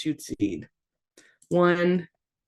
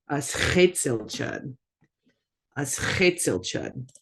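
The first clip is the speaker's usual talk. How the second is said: normal talking voice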